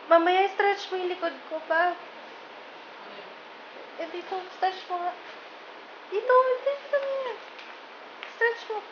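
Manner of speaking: high wheedling plea; whiny high request. Real, not acted. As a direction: coax him to stretch her back. Asking husband to stretch back.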